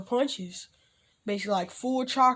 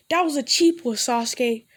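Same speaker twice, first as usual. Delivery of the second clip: taunting shout; 3 semitones higher, 6 dB louder than usual. Acted. Naruto taunting Sasuke after fight.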